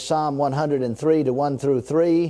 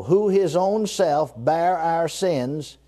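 slow reading. Shown as a demonstration. Preacher reading the verse slowly to the congregation, unhurried. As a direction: read slowly, word by word. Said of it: "Now I'm gonna read it slow".

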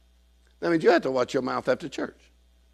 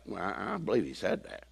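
unconvinced mumble; 5 semitones lower, 9 dB quieter than usual. Retold or imitated. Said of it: "You're like".

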